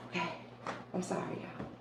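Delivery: soft unvoiced sorry; whispered apology. Real, not acted. Apologizing after calming herself.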